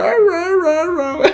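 high sharp ra ra barks; dog barking. Retold or imitated. Explaining what the dog means, high imitated barks. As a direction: bark like the dog. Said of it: "ese ra".

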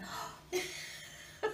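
a sudden high breathy intake of shock; sharp retold gasp. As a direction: gasp the way she did on hearing it. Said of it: "And she went".

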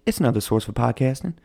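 fast, put-on salesman explaining; lightly voiced salesman; voicing Anchor. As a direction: rattle it off like a pitchman. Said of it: "He's like".

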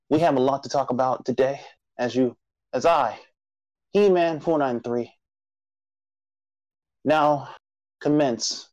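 rally the nation like a solemn show herald; grand announcer intro. Acted.

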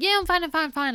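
high, hurried reassurance; brushing it off. Retold or imitated. Imagined reply to a concerned friend, high and dismissive. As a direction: insist breezily, pitched well up.

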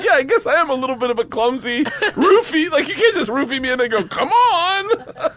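high, loud, breaking into laughter; laughing mock indignation. Comic answering the would-be roofier, laughing indignant comeback. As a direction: protest through helpless laughs.

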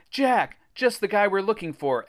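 high, menacing-playful call. A narrator's reading. Alex charges toward Jack, calling out. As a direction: hail a target from across the beach. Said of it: "Alex called".

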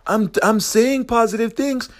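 high, squeaky mock whine of confusion; mock confused whine. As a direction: pitch way up like a puzzled whiner. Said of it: "you're like".

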